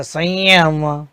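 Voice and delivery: संयम drawn out, nasal glide into य; slow pronunciation demo. Shown as a demonstration. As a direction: say संयम slowly for the learners. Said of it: "उसका उच्चारण य की तरह होता". (संयम को धीमे, य जैसी नासिक ध्वनि के साथ)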